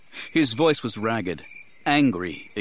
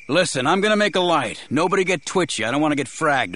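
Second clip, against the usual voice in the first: higher, raised, wary call; calling out warning; 6 semitones higher, 4 dB louder, faster than usual. A narrator's reading. Man warns others before making a light, calling out. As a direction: call a warning across the dark.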